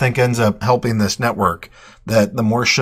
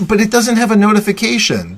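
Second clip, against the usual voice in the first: high, insistent objection; playful protest; 10 semitones higher, 4 dB louder than usual. Real, not acted. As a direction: argue back in a raised pitch. Playful argument about messaging app.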